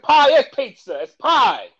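loud, high, insistent New York guy; mock New Yorker. The New York pizza man corrects the customer, loudly and high. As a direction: set him straight, loud and high. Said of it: "He said".

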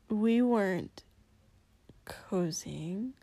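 denial fading into a whisper; muttered. Acted. Shoto denying flirting with Kacchan, muttered. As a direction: grumble it, trailing to a whisper. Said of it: "Shoto muttered".

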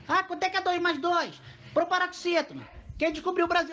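high-pitched mocking quiz questions; mimicking the teacher. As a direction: parody a teacher quizzing children in a high voice. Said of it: "Tudo ela pergunta pras crianças". (imitação aguda e debochada da professora)

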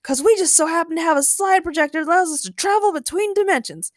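high, eager character voice; excited shout. A narrator's reading. Ray tells of the slide projector, excitedly. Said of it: "Ray shouted".

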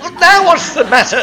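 exasperated. Acted. Exasperated host interrupts.

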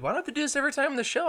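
high, fast and loud complaint; fed-up host complaining to cohost, fast and high; exasperated outburst. Real, not acted. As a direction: rattle it off in frustration.

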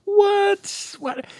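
squeaky high disbelief; high pitched what what; shocked. Real, not acted.

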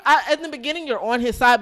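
usual speaking voice

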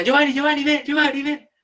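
high-pitched mock yell for help; put-on high cry summoning someone; imitated calling out. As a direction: re-enact an urgent call, volume held back. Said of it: "Y grita, le grita".

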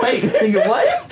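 high, incredulous squeal through laughter; laughing disbelief. Retold or imitated. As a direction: retell the shock, voice shooting up. Retells shocked reaction while laughing.

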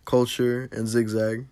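loud, slow show opener; podcast host intro. Acted. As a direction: announce it like a host. Host opens the podcast loudly.

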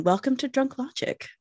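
normal talking voice

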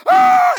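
high loud yelp of fright; loud high retold scream; startled scream. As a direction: let out a sudden scared yell. Gun in his face.

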